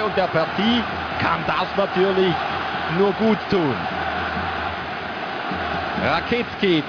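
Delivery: ordinary delivery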